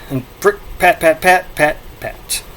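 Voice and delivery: fading pat pat, to a whisper; soft footstep sound effect. Shown as a demonstration. Vlogger ending the reading with fading pats. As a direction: tap out the patting, trailing off. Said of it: "dramatic reading".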